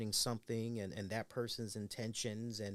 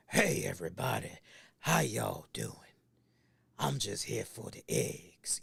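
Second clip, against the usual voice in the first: Al Pacino impression; 4 semitones lower, 4 dB louder than usual. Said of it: "Al Pacino come to your nearby ihop".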